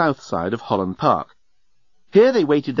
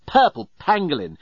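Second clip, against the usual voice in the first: higher-pitched startled exclamation; 4 semitones higher than usual. A narrator's reading. Journalist stuck in a traffic jam, exclaiming. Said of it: "exclaimed the journalist".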